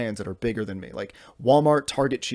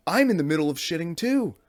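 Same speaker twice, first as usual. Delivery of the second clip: higher, pleading pushback; teen in the stall refusing, raised pitch; exasperated protest; 7 semitones higher than usual. Retold or imitated. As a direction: squeak out an indignant refusal. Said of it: "I'm like".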